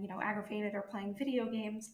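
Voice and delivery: ordinary talk, nothing marked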